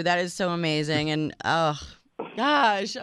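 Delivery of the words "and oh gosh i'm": crying a little. Real, not acted. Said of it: "made me cry".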